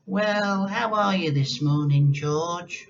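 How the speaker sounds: a mock-sweet granny greeting; imagined kindly grandma greeting George. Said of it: "had she smiled at George and said".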